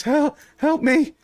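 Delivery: high, slow plea for help; pleading captive. Acted. Searched elf begging the party for help, high pleading voice. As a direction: plead high-pitched, drawing it out.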